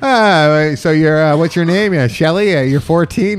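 ordinary delivery

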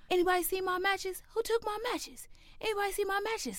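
very high mock uncle voice; imitated chain-smoker uncle. Chain-smoking uncle hunting his matches, imitated high-pitched. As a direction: put on a high, pestering uncle. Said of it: "He's a chain smoker, and he's like".

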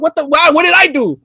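high-pitched, bewildered self-defence; mock protest. Son threatened with a beating by parents, mock protest. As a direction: plead innocence like a wrongly blamed son.

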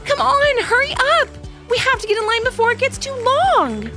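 put-on high excited kid shouting. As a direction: call out eagerly, high and loud. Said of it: "shouted Haven".

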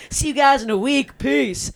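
high, loud swaggering farewell; cocky. Retold or imitated. Teen bragging to classmates on the way out, high cocky voice. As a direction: strut out like you own the school. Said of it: "this is me, all cocky".

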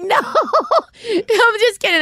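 high, drawn-out joking no; laughing. Real, not acted. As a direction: laugh through the denial. Jokes, laughs while retelling.

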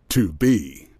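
sudden very low deep voice. Shown as a demonstration. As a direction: drop the last word way down. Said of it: "No deep voice required".

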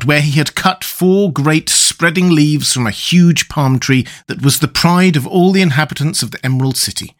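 ordinary delivery

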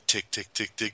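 soft, toneless tick-tick; imitated ticking. Host re-enacting the minute it took him, soft ticking. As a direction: tick quietly before the big reveal. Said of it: "Like, the whole".

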